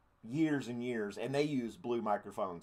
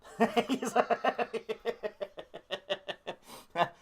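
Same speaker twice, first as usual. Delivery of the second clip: high cackling laugh trailing off; real drawn-out fit of laughter; long laugh; 10 semitones higher than usual. Real, not acted. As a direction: crack up at a joke. Laughing at a chat comment.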